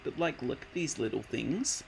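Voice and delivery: ordinary delivery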